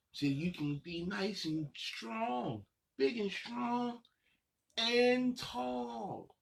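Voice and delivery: playful build up. Acted. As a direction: build up like growing taller. Cheering kids to grow strong.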